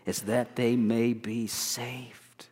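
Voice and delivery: slow, hushed whisper; whispered, slow reading of the prayer. Acted. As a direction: breathe the words like a prayer. Quoting Paul's prayer softly.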